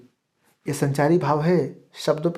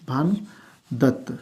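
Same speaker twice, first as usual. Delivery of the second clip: Bhanudatta drawn out slowly, syllable by syllable; 5 dB quieter, much slower than usual. Shown as a demonstration. Teacher repeating the scholar's name for students, slow. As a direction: stretch Bhanudatta out so students catch it. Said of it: "आराम से सुनिएगा".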